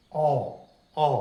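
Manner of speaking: अ said as a rounded open "ऑ", between ओ and आ; borrowed ऑ vowel. Shown as a demonstration. Teacher showing students the borrowed vowel, rounded ऑ sound. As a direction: shape अ like the ऑ in डॉक्टर, mouth round and open. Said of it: "इसका उच्चारण ओ और आ जैसा होता है".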